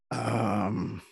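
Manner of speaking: throat catching, struggling to talk; choking. Real, not acted. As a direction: talk through a choking fit. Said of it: "I choked on nothing here".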